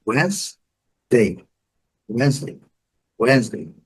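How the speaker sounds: "Wednesday" as WENZ-day; two-syllable "Wednesday", first one strong. Shown as a demonstration. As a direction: say "Wednesday" with silent D, stressed start. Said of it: "primeira sílaba forte".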